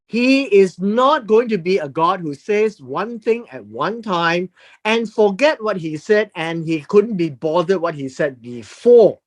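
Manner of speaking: usual speaking voice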